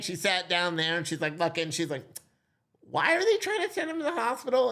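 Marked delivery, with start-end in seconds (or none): 2.9-4.7 high, incredulous doctor's voice; doctor questioning the referral, pitched much higher; puzzled doctor. Retold or imitated. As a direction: raise it up in disbelief. Dad voicing the doctor.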